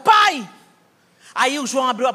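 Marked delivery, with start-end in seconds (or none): 0.0-0.5 higher, louder cry of "Pai"; angry shout. Retold or imitated. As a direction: stop your father with one word. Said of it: "entrei na frente do meu pai e falei".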